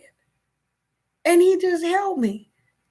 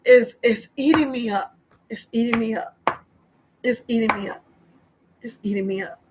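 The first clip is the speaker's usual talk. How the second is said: fading into soft, lower tearful repeats; crying; 6 semitones lower, 4 dB quieter, faster than usual. Real, not acted. Overwhelmed by the tax mess, she fades into tears. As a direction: let it sink quieter each time as tears come. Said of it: "I'm crying again".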